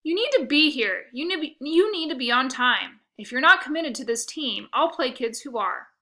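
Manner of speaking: loud, firm coach scolding a late player; stern coach. A narrator's reading. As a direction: lay down the law to the kid.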